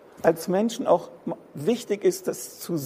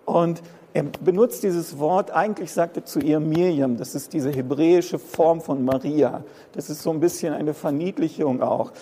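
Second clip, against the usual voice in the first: ordinary talk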